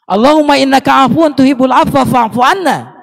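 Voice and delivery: prayer recited loud for the crowd to amen; loud prayer, laughing. Shown as a demonstration. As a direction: say it up so they hear. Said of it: "Nggak ada kedengeran ya?".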